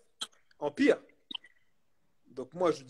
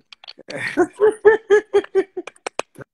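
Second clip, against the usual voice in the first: laughing; 13 semitones higher, 9 dB louder than usual. Real, not acted. Laughing after "ta gueule.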